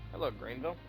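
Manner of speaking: ordinary delivery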